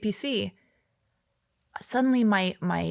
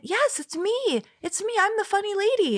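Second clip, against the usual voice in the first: much higher, thrilled yes-it's-me; eager excitement; 14 semitones higher than usual. Retold or imitated. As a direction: squeal it like a delighted fan. Proud reply to the boss.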